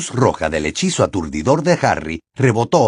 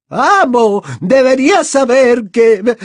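high, loud, wailing plea; desperate pleading; 14 semitones higher, 7 dB louder, slower than usual. Acted. Bellatrix trying to warn Voldemort, desperately pleading. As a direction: beg the master to listen.

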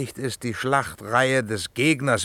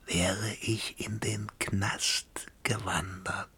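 slow, secretive whisper; whisper in ear; 8 dB quieter, slower than usual. Acted. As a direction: whisper it right into his ear. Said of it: "komm, ich sag's dir ins Ohr".

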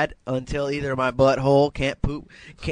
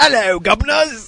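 high, bright-eyed British put-on greeting; mock British accent; 9 semitones higher, 4 dB louder than usual. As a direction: ham up a posh British gent, pitched up. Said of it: "when I do the British accent".

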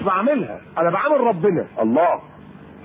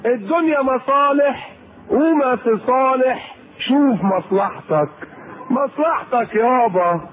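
slow, higher mock of selfish talk; mocking worldly people; 3 semitones higher, slower than usual. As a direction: drawl it out like a cynic scoffing at charity. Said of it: "الناس يقولوا كده". (بسخرية، بصوت أعلى وأبطأ)

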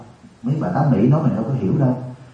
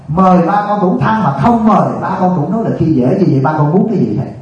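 imitated child, pitched up and insistent; questioning child; 6 semitones higher, 4 dB louder than usual. Child questions father's endless complaining, imitated exasperated challenge. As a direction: play an exasperated kid challenging a parent. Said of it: "Thì đứa nhỏ này nó hỏi".

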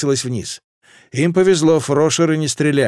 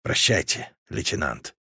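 very soft, much lower whisper; whispered farewell; 9 semitones lower, 8 dB quieter than usual. Acted. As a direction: breathe out a last goodbye. Dying captain's final farewell.

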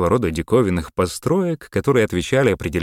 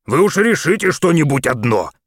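loud, high protest; fed up with mixed orders; exasperated; 10 semitones higher, 5 dB louder than usual. A narrator's reading. Maxwell snapping back at the mayor over the radio.

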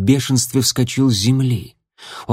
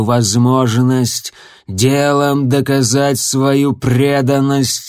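slow, icy, every word spaced; cold and deliberate; 3 dB louder, slower than usual. Acted. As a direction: spell out each word coldly. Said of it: "холодно выговаривая каждое слово".

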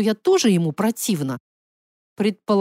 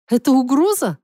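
high, louder startled question; nervous challenge; 5 semitones higher, 5 dB louder than usual. Acted. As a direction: ask it with a nervous edge, pitched up. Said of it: "У меня вырвался нервный смешок". (нервный вопрос повышенным голосом)

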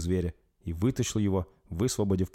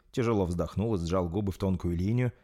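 usual speaking voice

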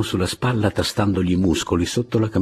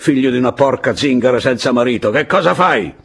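higher, loud burst of abuse; angry scolding; 7 semitones higher, 5 dB louder than usual. A narrator's reading. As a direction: hurl insults at a lazy drunkard.

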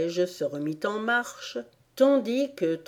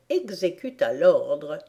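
ordinary delivery

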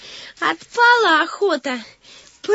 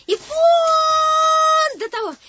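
drawn-out call; 7 semitones higher, much slower than usual. Acted. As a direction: stretch it out and call over. Pointing far across the chasm.

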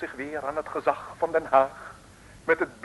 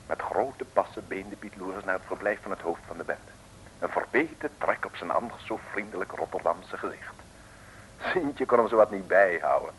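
pompous pulpit storyteller voice; mock sermon narration; 6 semitones lower, 3 dB quieter than usual. A narrator's reading. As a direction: address the audience like a preacher, then tell the tale softly.

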